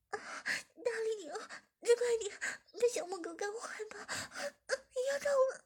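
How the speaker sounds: moaning. A narrator's reading. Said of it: "志敏马上呻吟道".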